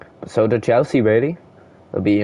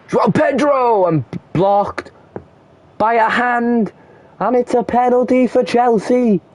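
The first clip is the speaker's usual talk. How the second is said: excited commentary call; 13 semitones higher than usual. Real, not acted. Commentator calling a handball that wins a penalty, excited high shout. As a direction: ride the moment as the ball hits a hand.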